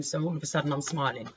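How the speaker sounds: ordinary talk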